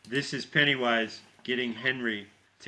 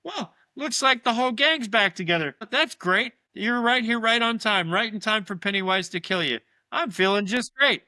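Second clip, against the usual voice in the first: high mocking creepy voice; sing-song taunt from a severed head; Stanley's severed head; 10 semitones higher, 6 dB louder than usual. Stanley's head taunting the gang, high and mocking. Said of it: "and he's like".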